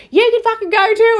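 high, loud mocking snap back. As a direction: imitate her snapping and blocking. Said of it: "she's like".

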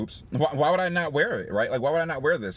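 ordinary talk

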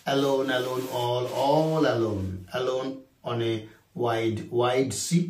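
slow, soft poem reading; poem recital. Shown as a demonstration. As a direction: recite the line slowly and softly. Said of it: "যেমন আমরা বলেছিলাম না".

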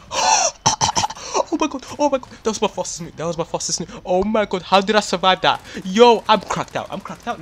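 very high, loud screams with a laugh and gasp; ecstatic screaming. Real, not acted. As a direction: lose it completely over the record run. Fastest nuke, screaming with joy.

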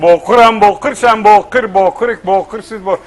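put-on loud, heavy Kurdish-accented recitation. Kurdish pupil conjugating for the teacher, thick Kurdish accent. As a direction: chant the verb forms in a comic Kurdish accent. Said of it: "Kürdonla demiş".

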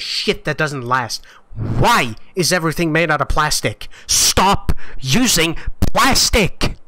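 furious rant. Real, not acted. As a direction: yell it at the manufacturers, boiling over. Streamer raging at broken headset.

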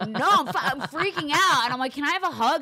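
high, loud mock freak-out; hammed-up frantic pleading; acted-out panic. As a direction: replay a panicked plea to a husband. Replaying her panic to her husband.

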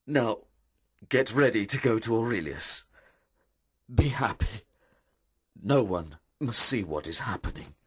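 halting, firmer husband; mildly choked-up instruction; holding back tears. Acted. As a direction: steel yourself between phrases. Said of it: "he suddenly began to cry".

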